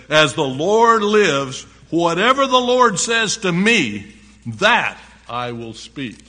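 loud, slow, solemn oath; resolute declaration. A narrator's reading. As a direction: ring it out firmly, dropping on the last phrase. Prophet's defiant oath, read aloud.

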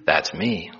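low, understated confirmation; a hint of God, calm and low; lightly voiced Lord. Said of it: "I am the Lord".

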